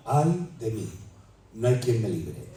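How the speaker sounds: low, soft despairing cry; soft, low, despairing plea; hushed despair. Acted. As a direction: murmur it as a lost man.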